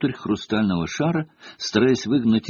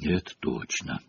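low, soft aside; murmured agreement; 5 semitones lower, 9 dB quieter than usual. A narrator's reading. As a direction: agree under your breath to a friend. Harry murmurs back in class. (тихо, вполголоса)

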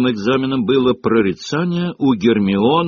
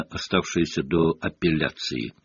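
ordinary delivery, nothing marked

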